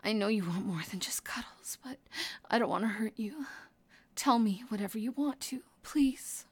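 low, hushed, intimate murmur; tender reassurance. Acted. Speaking to an injured lover in pain, tender. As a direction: soothe softly, close and gentle.

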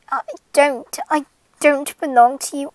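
ordinary talk, nothing marked

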